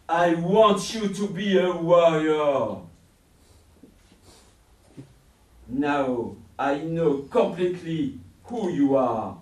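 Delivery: loud, booming villain voice; commanding villain. Acted. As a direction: boom it like a tyrant. Villain reveals his army.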